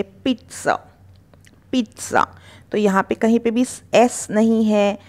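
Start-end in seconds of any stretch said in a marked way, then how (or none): 0.0-2.3 पिज़्ज़ा run together as "peet-sa"; blended ts sound. Shown as a demonstration. Teacher putting pieces together, पिज़्ज़ा as "peet-sa". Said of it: "जल्दी से बोलेंगे".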